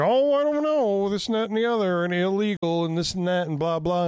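high-pitched mock hemming and hawing; mock reluctance. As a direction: imitate someone's waffling objections, starting high and easing down. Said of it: "He's like".

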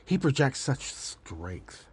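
ordinary talk

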